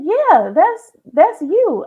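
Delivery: mock mom's high, loud confirmation; matter-of-fact mom. Mother confirming it to upset child.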